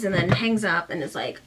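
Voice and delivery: usual speaking voice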